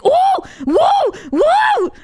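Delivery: repeated very high shouted whoas; loud, high arcade-win whoa; excited whoa. Retold or imitated. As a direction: jump up and down squealing over a prize. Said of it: "go like".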